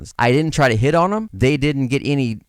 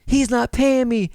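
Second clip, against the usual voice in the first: put-on upset girl; 11 semitones higher, slower than usual. Girl complains about client.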